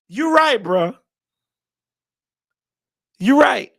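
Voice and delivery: hyped agreement. Real, not acted. Answering himself, hyped.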